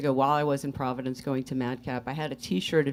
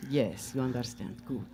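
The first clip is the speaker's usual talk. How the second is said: put-on Russian accent. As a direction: explain it as Svetlana in Russian accent. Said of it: "Where did you learn the accent?".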